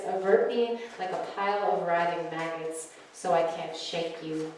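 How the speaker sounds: soft, low spoken-word delivery; hushed, sensual poetry reading; poem reading. Acted. Reads her poem at open mic.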